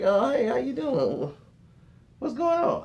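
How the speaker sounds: high, nervous polite hello; a hint of forced cheer; mock nervous greeting. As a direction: greet the uncle a bit too eagerly. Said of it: "I was like".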